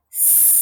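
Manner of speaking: long unvoiced s hiss. Shown as a demonstration. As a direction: hiss like a snake. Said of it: "And what sound?".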